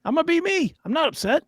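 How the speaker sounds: ordinary talk, nothing marked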